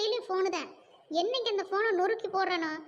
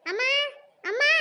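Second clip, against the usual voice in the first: calling out; 5 semitones higher, 5 dB louder than usual. Acted. Calling mother during the quarrel.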